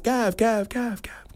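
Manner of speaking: high echo fading to a whisper; mimicked echo. Decaf announcement echoes to Mount Cootha, fading mimicked echo.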